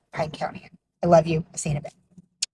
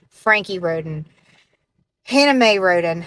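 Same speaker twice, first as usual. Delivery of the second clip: ordinary talk, nothing marked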